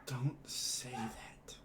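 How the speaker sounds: slow unvoiced whisper; hushed slow plea. Real, not acted. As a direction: breathe the words out slowly with no voice. Player reassures grieving character.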